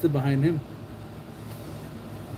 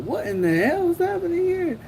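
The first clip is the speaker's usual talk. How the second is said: high, disbelieving squeak; incredulous; 12 semitones higher than usual. Retold or imitated. Streamer recalling his reaction to a stunt, high incredulous squeak. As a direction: react like you can't believe your eyes. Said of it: "I was like".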